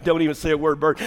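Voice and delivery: ordinary talk, nothing marked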